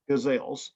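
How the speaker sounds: "gazelles" said guh-ZELZ, stress on the second syllable; variant pronunciation. Shown as a demonstration. Poet showing listeners ways to name the form, "gazelles" said guh-ZELZ. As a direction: say "gazelles" like the antelopes. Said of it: "they can be pronounced".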